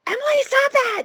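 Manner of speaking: put-on eerie high child voice; creepy baby voice. Babysitter scared by creepy child voice. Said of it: "freak out your babysitters with that voice".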